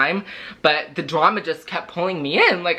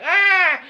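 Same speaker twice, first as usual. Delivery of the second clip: a sudden, much higher mock cry, short and loud; imitated kid cry; 13 semitones higher, 4 dB louder than usual. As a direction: yelp like a kid being grabbed. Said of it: "the kid's just like".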